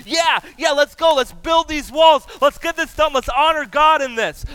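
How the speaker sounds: rally the people like a pumped-up crew; fired-up crowd. Retold or imitated. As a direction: jubilant builders shouting they're ready. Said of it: "gotten the people all fired up".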